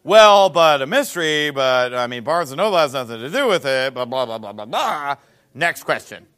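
low dismissive mock of a caller; mock arguing. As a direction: imitate a caller arguing, trailing into low blah-blahs. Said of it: "Argues with me a little bit".

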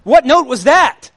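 loud, high, joyful yell; preacher acting out a happy churchgoer shouting for joy. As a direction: belt it out happily like you just hit a big note. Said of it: "have you ever been happy and you're just like".

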